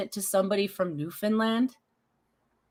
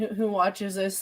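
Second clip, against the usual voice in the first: normal talking voice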